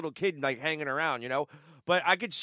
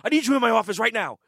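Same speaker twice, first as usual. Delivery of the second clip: louder, urgent summons; mock panicked boss; 4 semitones higher, 8 dB louder than usual. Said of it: "in a panic and goes".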